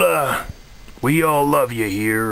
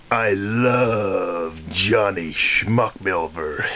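mock adoring; 3 semitones lower, slower than usual. Forced praise of new controller.

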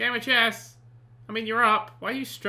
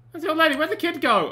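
alarmed; 8 semitones higher, 5 dB louder than usual. Real, not acted. Sudden alarm at missing sims.

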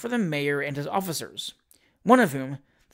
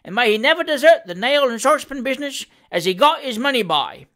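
much higher, louder heckle; mocking cry; 5 semitones higher, 6 dB louder than usual. A narrator's reading. Voice in the crowd heckling the mayor, loud mocking cry. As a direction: cry out a sarcastic cheer. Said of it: "cried the voice".

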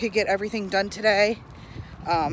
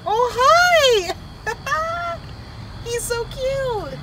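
cooing delight; much slower than usual. Real, not acted. Vlogger coos at the mantis by the car, high and gushing. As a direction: gush over something adorable.

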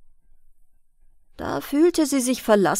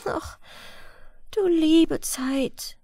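slow, higher, sighing lament; girl sighing over her loss; 6 semitones higher, much slower than usual. A narrator's reading. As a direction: let it out as a slow sigh. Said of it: "seufzte sie da".

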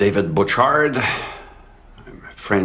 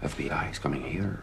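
whisper; 4 semitones lower, 14 dB quieter than usual. Acted. Undercover cop's secret relief.

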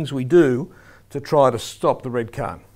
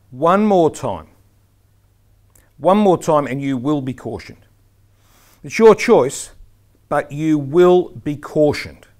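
loud, firm public warning with weighty pauses; stronger, more aggressive; 7 dB louder, slower than usual. Shown as a demonstration. Referee publicly warning a player, strong and aggressive. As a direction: get the point through forcefully without screaming. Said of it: "It was stronger".

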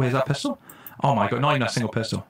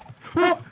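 sharp gasp. Real, not acted. Startled by mob.

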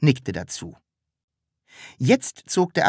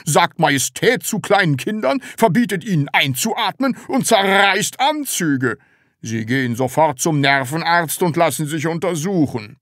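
raised, indignant boss voice; outraged department head; 5 semitones higher, 3 dB louder than usual. A narrator's reading. Department head orders seller to a doctor, outraged. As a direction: scold in a higher, put-on voice.

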